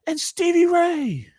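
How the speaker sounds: put-on high, slow drunken shriek of a name; slurred, stretched heckler's holler; drunk scream. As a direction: yell it across the hall like a tipsy fan. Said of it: "some drunk lady in the crowd screams out".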